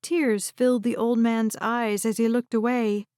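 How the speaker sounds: ordinary delivery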